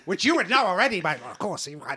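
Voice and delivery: high, loud awed creature voice; groveling creature. Acted. Fish creature groveling to the player's fake god, high and awed.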